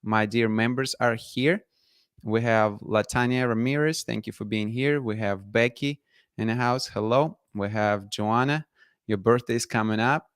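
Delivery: usual speaking voice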